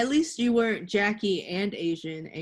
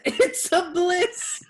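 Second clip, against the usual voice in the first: laughing repeat; 11 semitones higher, 4 dB louder, much slower than usual. Acted.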